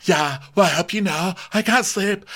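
high-pitched imitation of complaining; hammed-up high grievance; put-on complaint. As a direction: whine it in a high voice. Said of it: "She goes".

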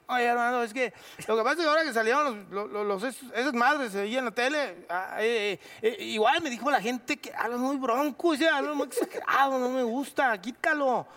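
high rambling boxer voice; Julio Chávez imitation. Julio calling the actor to complain.

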